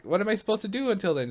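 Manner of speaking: high, quick mock-anxious question; worried mother. Mother pressing the operator for advice, mock anxious.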